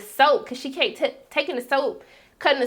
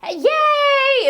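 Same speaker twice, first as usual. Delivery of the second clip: high, loud, bubbly squeal; gushing Janine; 6 dB louder than usual. Acted. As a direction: burst in, much too cheerful and loud. Janine cheerfully slipping in soap.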